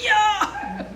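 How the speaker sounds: high-pitched, eager exclamation; playful, squealed mock excitement; excited dog imitation. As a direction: voice a dog thrilled by food. Voicing the dog's excited reaction.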